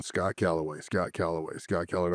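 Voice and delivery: low, soft repeated muttering; stressed self-talk. Retold or imitated. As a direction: repeat it under your breath, trying to remember. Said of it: "because of the stress, I was going".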